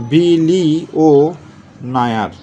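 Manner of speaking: drawn-out বিলিও, clipped Nair; slow spelling aloud. Shown as a demonstration. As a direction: stretch বিলিও out very slowly, then a quick Nair. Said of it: "এখানে আমরা লিখলাম কেমন?".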